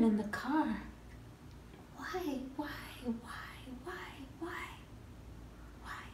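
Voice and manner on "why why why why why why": whispered, repeated plea; hushed anguished questioning. Acted. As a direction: whisper it over and over, fading away. Quietly questioning in the car.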